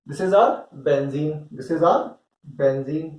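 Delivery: very slow, word by word for notes; slow dictation. Shown as a demonstration. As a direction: spell it out slowly so students can write.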